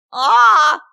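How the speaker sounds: high, very loud whooping cry; sudden explosive "A!" yell. A narrator's reading. Silly guest erupts mid-cake, high loud yell. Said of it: "закричал Дурилов".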